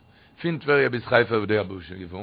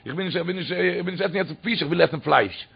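ordinary delivery

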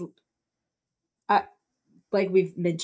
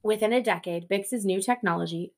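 ordinary talk

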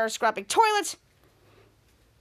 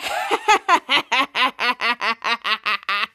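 imitated laugh; 4 semitones lower, 4 dB louder than usual. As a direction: lower cackling laugh, played as Schwoz. Schwoz laughing at the livestream.

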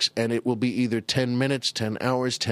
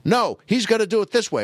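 ordinary talk, nothing marked

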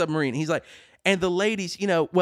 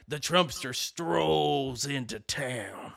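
slow, low gunslinger voice, softer; Western narrator; 5 semitones lower, 6 dB quieter, much slower than usual. Retold or imitated. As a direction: draw it out like a movie cowboy. Wild West pose quote.

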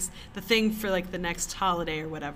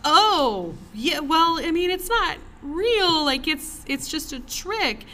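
much higher, slower, nervously soothing; awkward placating; 9 semitones higher, 6 dB louder, slower than usual. Retold or imitated. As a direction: try to calm her down, flustered and sheepish. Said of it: "he's like".

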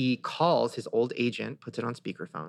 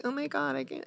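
high, softer mock gasp of dismay; mock tweeters; 12 semitones higher, 6 dB quieter than usual. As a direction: imitate people gushing online, pitched way up. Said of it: "all these people tweeting, like".

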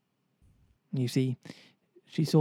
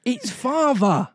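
much higher, louder and slow, stunned discovery; astonished exclamation; 11 semitones higher, 6 dB louder, slower than usual. Acted. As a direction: exclaim it in disbelief. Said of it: "exclaimed Wendy".

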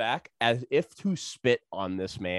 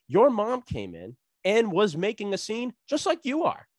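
scolding; 10 semitones higher than usual. Retold or imitated. Manager retold scolding the customer.

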